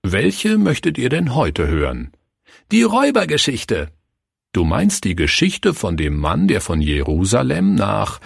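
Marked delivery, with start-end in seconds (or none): 2.7-3.9 put-on eager child. Child answers the pastor.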